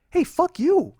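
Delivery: sudden high angry yell at her. Retold or imitated. To girlfriend being shitty in the car, a furious shout. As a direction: snap back hard, rising high and loud.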